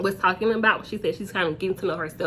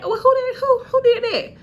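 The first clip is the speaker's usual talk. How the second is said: much higher and louder, laughing disbelief; mock shocked; 6 dB louder than usual. Kimmy hears the vaping gossip, mock shocked. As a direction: imitate her stunned laugh. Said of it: "Kimmy was just like".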